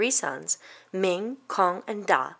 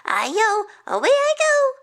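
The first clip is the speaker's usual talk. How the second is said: high cartoon call, growing louder; gleeful rice cake cry; 14 semitones higher, 5 dB louder than usual. A narrator's reading. Rice cake escaping a market woman, high gleeful cry. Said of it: "It cried".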